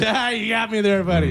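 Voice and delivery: Adam Sandler impression. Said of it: "I got to imitate Adam Sandler".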